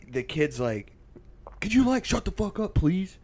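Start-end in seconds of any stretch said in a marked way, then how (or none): 1.6-3.2 high, mock exasperated plea; pissed-off kid. Pissed kid to his yelling dad, mock exasperated. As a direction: imitate a fed-up son snapping back. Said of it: "the kid's pissed".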